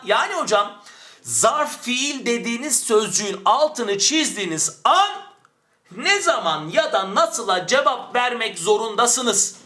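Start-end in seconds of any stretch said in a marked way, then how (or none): 1.2-9.6 slow, high-pitched declaiming, peaking on "an"; emphatic proclamation. Shown as a demonstration. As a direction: proclaim the rule word by word, slowly. Teacher proclaims the key rule. (ağır ağır, yüksek perdeden, vurgulu)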